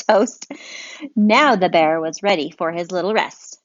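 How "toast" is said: laughing reveal. Real, not acted. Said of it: "what's he eating?".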